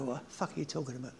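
quick, soft, laughing mock retort. Boy baffled by the Norfolk shop greeting, softly laughing. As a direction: toss it off through a laugh.

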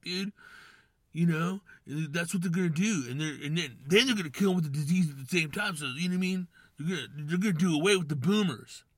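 tinfoil hat voice. Retold or imitated. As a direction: sell the boomer plot like a true believer. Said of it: "That's my tinfoil hat voice".

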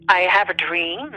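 ordinary talk, nothing marked

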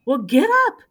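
high, loud push to get moving; loud urging. Retold or imitated. Tom Cope urging her up to the podium, loud and high. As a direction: bark it out to get her on her feet. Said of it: "Tom Cope says to me".

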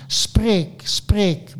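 insistent storyteller's roar; roared command. A narrator's reading. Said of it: "brulde ik".

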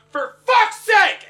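high, loud, drawn-out yell; furious scream. Acted. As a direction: scream it in exasperation while running. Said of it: "am fucking screaming after this guy".

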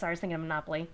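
ordinary talk, nothing marked

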